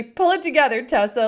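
loud, high mock scolding to listeners; playful catchphrase telling everyone off; hammed-up stern mother; mock stern mom. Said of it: "So everybody".

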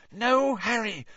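put-on high, frightened girl's gasp; high-pitched fearful plea; petrified gasp. A narrator's reading. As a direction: gasp it out, terrified. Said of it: "Hermione gasped in a petrified whisper".

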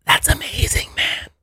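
breathy whispered wonder; awed whisper. Retold or imitated. High driver marveling at the U-turn, whispering. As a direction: whisper it, dazzled. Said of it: "I was like".

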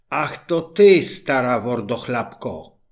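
sharp, loud startled cry; startled exclamation. A narrator's reading. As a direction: jump at the sight of the frog. Princess startled by frog.

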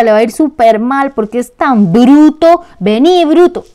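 put-on a mother yelling insults at her little boy; scolding mother. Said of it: "una mamá que todo el tiempo le decía a su chiquito".

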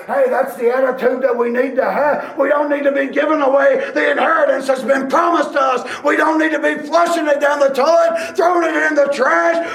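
high, loud shouted preaching, slowing on the last lines; full-force pulpit climax; fiery preaching. Acted. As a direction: drive the point home to the church.

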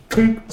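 high, loud glassy tink; ping it like glass on wood. Shown as a demonstration.

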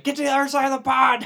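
squeaky rushed command; high fast mock coaching. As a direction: yell it high and quick. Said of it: "We're like".

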